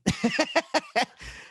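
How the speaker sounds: a quick high laugh, far up the range; high burst of laughter. Real, not acted. Laughing at own joke about everyone being crazy, high laugh. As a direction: break into a bright laugh.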